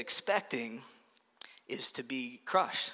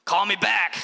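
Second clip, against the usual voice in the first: loud, higher mock text-shout; punchy, raised-voice imitation of texting; all-caps emphatic; 4 semitones higher, 5 dB louder, slower than usual. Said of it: "in all caps and be like".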